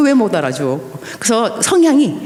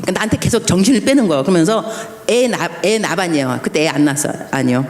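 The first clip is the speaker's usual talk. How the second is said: normal talking voice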